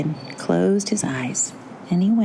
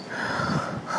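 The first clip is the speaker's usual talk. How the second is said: soft, breath-only gasp; small storybook gasp of hope; 7 dB quieter than usual. A narrator's reading. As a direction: draw in a quiet hopeful breath. Pumpkin reacts with hope.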